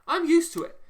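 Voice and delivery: shouting it out in real frustration; exasperated shout. Real, not acted. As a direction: blow up on the last repeat. Peak of the repeated rant.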